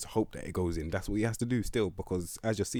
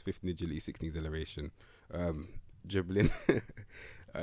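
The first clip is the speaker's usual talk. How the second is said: ordinary talk, nothing marked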